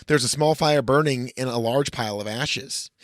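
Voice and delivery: ordinary talk, nothing marked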